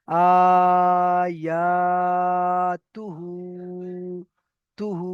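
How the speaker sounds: long আ and ইয়া, short তু, long হু; stretched final syllable. Shown as a demonstration. Teacher modelling the word, হু drawn out long. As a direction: hold হু out after a brief তু. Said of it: "এখানে হুটাকে টান করলাম".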